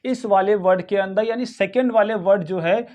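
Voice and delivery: ordinary talk, nothing marked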